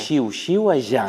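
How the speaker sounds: "Xiu, xiu, allá" with two hushing sh and a buzzing zh; sh, then voiced zh. Shown as a demonstration. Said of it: "la última será sonora". ("Xiu, xiu, allá" con dos sordas y final sonora)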